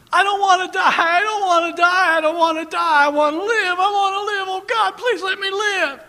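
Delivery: high, loud desperate cries; dying man screaming. Retold or imitated. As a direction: wail it like a dying man begging to live. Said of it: "he'd scream and cry out".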